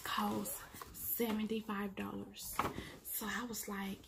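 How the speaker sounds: hushed confession of the price; whisper. Real, not acted. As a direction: whisper it like it's scandalous. Confiding the price.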